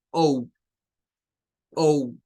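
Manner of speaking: "O" said as a glide from O into U; long O. Shown as a demonstration. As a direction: blend O into U for a long English "O". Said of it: "es un sonido de O U".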